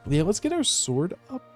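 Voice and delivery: ordinary delivery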